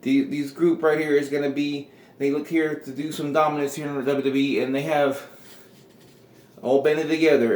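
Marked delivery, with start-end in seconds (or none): none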